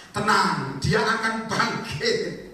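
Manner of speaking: loud, very slow reassurance; Jesus calming. Retold or imitated. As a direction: stretch each word to calm her. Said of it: "Sudah tahu Yesus ngomong apa?".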